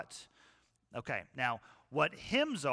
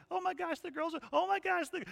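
very high-pitched imitation; mock gushing; 14 semitones higher than usual. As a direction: squeal it in a put-on high voice. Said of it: "and I'm going".

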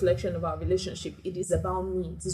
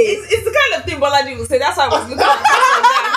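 laughing; 9 semitones higher, 12 dB louder than usual. Real, not acted. Friends laughing at a joke.

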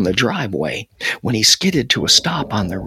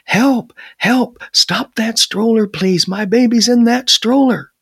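high, loud, desperate cries for help; pleading; 10 semitones higher, 4 dB louder than usual. A narrator's reading. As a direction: beg urgently at a raised pitch. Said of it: "and he pleaded".

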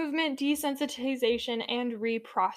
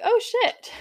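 surprised researchers; 12 semitones higher, 6 dB louder than usual. Retold or imitated. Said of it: "they were like".

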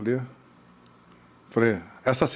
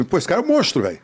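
retold amazed praise; 5 semitones higher, faster than usual. Director marvelling at the actor's screen test, excited praise. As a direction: exclaim like a director blown away.

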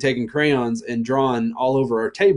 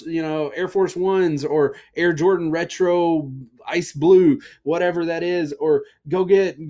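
joyful father calling out; 5 semitones higher than usual. Retold or imitated. Father calling the servants to celebrate, jubilant. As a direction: announce the party with delight.

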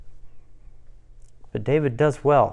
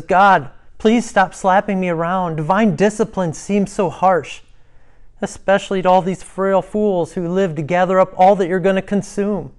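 higher, louder protest to God; David pleading; 6 semitones higher, 5 dB louder than usual. Acted. Preacher voices David protesting God's discipline, pleading. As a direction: plead against the harsh treatment.

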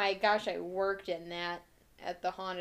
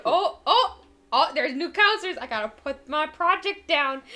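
Jason voice; 6 semitones higher, 10 dB louder than usual. Pretend. Voicing Jason knitting at camp.

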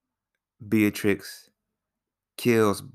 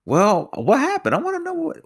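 surprised. Real, not acted. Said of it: "own curious outburst".

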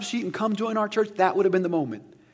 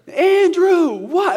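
much higher and louder shouted call; imitated yelling; 12 semitones higher, 11 dB louder, much slower than usual. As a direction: shout it up the stairs, impatient. Parent and kid yelling back and forth.

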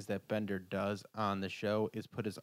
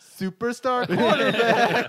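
high, loud, slow, laughing hype; hyped-up announcer; 11 semitones higher, 12 dB louder, slower than usual. Pretend. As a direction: stretch it out like a big reveal. Mocking the owner's hype.

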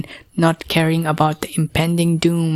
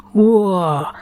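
thrilled whoa; 3 semitones higher than usual. A narrator's reading. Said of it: "Kai exclaimed".

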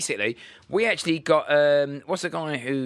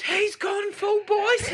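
high, drawn-out mock shout; onlooker calling out; much slower than usual. Passer-by across the street spots the impression, mock shouting. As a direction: holler it slowly across the street. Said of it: "Someone across the street goes".

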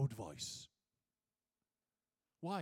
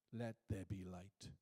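low, slow, softer pronouncement; quoted divine command; 7 semitones lower, 5 dB quieter, slower than usual. Acted. Preacher quoting God's word at creation, low and measured. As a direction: say it slowly, low and unforced.

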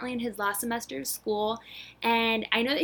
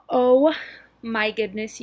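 normal talking voice

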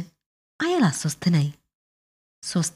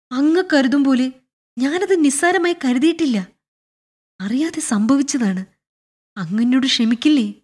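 higher-pitched, earnest plea; pleading apology; 7 semitones higher than usual. Acted. Clerk begs the general to forgive his mistake, pleading. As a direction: beg him to forgive you.

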